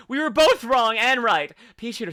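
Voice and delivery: ordinary talk, nothing marked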